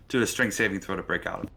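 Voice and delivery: ordinary delivery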